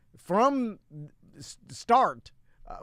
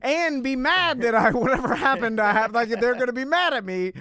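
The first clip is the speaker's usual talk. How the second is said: put-on panicked kid; 5 semitones higher, 5 dB louder than usual. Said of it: "I was like".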